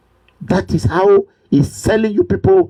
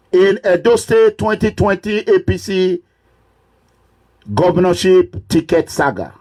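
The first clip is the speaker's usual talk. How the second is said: formal announcer; 4 semitones higher than usual. Real, not acted. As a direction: announce it solemnly, stretching each phrase. Formal opening proclamation of the broadcast.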